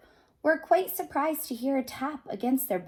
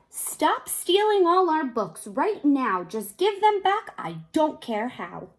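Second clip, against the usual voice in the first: shouting; 5 semitones higher, 6 dB louder than usual. A narrator's reading. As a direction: call out firmly into the night. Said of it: "shouted to the thing outside".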